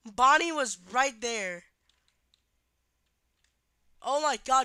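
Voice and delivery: loud slow scared words; tense, loud fear; panicking gamer. Real, not acted. Enemy at the door.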